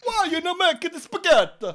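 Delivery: loud mock Italian accent. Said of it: "almost sounded Italian".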